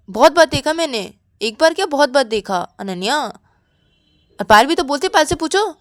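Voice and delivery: acted heated comeback; argumentative retort. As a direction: argue back insistently. Sakshi argues back at Ananya.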